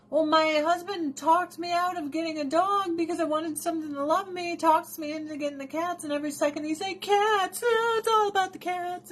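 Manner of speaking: high, fast mock-whiny complaint. Wife mocking her husband's cat obsession, high whine. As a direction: whine it out in a squeaky put-upon voice. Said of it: "voicing herself complaining to viewers".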